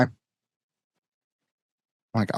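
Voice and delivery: ordinary talk, nothing marked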